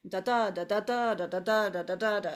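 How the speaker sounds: "da da da" with equal weight on every beat; evenly stressed syllables. Shown as a demonstration. Teacher imitating Italian rhythm, even stress. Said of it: "It's an even stress".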